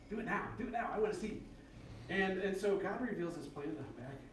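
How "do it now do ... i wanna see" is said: high-pitched impatient pleading; playful eager demand; mock impatience. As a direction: pester like someone who can't wait. Acting out impatience at waiting.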